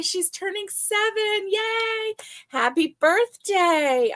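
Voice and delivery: high, bright-eyed cheer, slow and drawn out; excited birthday wishes. Real, not acted. Birthday wishes to viewer's daughter.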